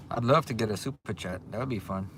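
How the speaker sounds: usual speaking voice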